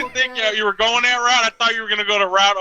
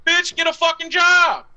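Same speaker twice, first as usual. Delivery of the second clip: high, loud scornful bark at a panhandler; exasperated mock shout, pitched way up; mock yelled retort; 11 semitones higher, 3 dB louder than usual. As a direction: yell it at the guy asking for change. Retold scolding of a beggar.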